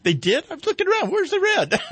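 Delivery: high, laughing, playing baffled; mock bewildered. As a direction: squeak it out, puzzled and amused. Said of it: "I said".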